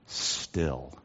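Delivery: low, soft, spaced-out words; quiet, low pulpit hush. Acted. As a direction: drop right down into stillness. Preacher hushes on the word still.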